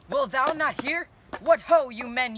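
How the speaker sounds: loud royal command; commanding shout. Acted. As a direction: shout them into silence. Prince shouts over the brawl.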